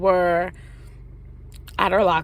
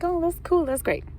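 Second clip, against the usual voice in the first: mock cheery; 9 semitones higher than usual. Fake-nice reply to friend.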